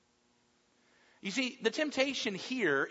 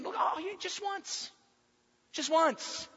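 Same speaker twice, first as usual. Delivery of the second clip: high-pitched wheedling plea; acted tempter, voice pitched right up; coaxing tempter; 11 semitones higher than usual. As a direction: coax them in a raised, pleading pitch. Satan coaxing Jesus, voiced.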